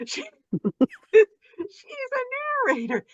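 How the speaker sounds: squealed very high and loud through laughter; retold excited exclamation. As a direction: burst out high, stretched and half laughing. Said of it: "I'm like".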